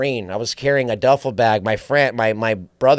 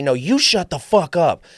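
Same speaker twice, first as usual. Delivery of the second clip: mock yell; 5 semitones higher than usual. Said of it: "some guy yells at me".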